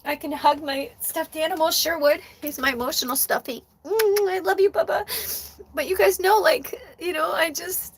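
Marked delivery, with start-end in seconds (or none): 3.8-5.0 high, loving coo; tearful affection. Real, not acted. Hugging an emotional stuffed animal, high tearful coo. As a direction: squeeze out a tearful endearment.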